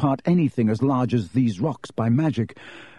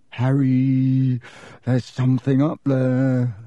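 hoarse; slower than usual. A narrator's reading. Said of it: "said Ron hoarsely".

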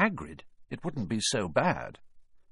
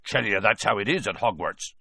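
usual speaking voice

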